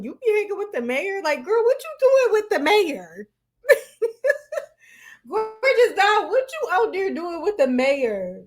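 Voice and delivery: very high mock-questioning, giggling; tickled incredulity. Reactor acts out questioning a friend, tickled incredulity. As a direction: imitate a stunned friend while cracking up. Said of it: "That just is tickling me".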